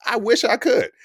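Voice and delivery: helpless plea about his dry mouth; exasperated. Real, not acted. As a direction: throw up your hands with the words. Repeats apology, exasperated.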